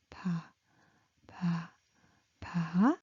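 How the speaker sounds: quiet, low "pa"; soft, low, separate "pa" syllables; low pitched, soft. Shown as a demonstration. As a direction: drop "pa" low and little. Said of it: "little and soft".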